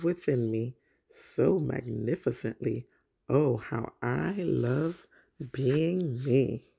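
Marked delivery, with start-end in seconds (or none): none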